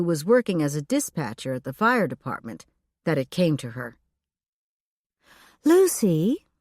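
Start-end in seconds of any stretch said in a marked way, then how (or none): 5.6-6.5 airy, high call of a name; breathy voice. A narrator's reading. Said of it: "came Elfrida's rather breathy voice".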